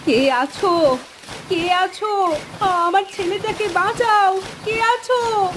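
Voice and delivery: high-pitched wailing plea; tearful, desperate mother calling out; crying for help. Acted. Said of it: "কাঁদতে কাঁদতে বলে".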